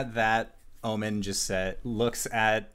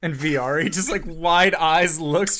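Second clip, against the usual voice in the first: usual speaking voice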